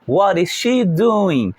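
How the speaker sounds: What is she doing, very slow; slow model question. Shown as a demonstration. Teacher repeats the she question for the class, slowly. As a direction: say it again slowly. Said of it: "então vamos repetir".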